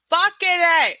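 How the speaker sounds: loud, high exasperated yell; frustrated shout. Real, not acted. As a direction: blurt it out furious at the hiccups. Frustrated outburst at hiccups.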